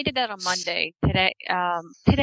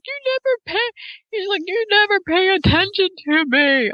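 much higher, whiny kid voice mocking the complaint; whining son; 11 semitones higher, 4 dB louder than usual. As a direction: mimic a sulky nine-year-old. Mother imitating her whining son.

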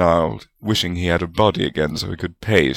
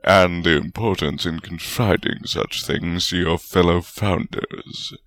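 dour. A narrator's reading. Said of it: "Godric added dourly".

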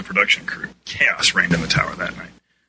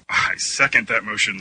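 ordinary talk, nothing marked

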